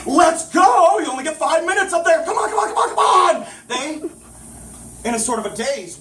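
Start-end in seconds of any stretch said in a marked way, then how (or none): 0.1-3.5 high, loud hurried yelling; frantic shout. Acted. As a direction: rush them on, shouting. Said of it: "he shouted frantically".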